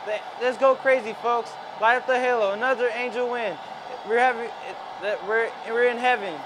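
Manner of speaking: excited celebration. Pretend. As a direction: whip up the crowd. Celebrating the grand slam.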